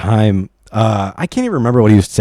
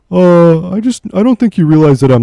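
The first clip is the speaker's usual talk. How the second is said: higher, louder mock coworker voice; imitating the coworker, raised and louder; mimicking coworker; 6 semitones higher, 4 dB louder than usual. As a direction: put on the coworker's hesitant tone, pitched up. Coworker voiced in retold argument.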